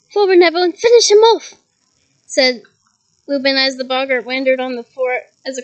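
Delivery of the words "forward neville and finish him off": urging shout. A narrator's reading. Teacher urging Neville on.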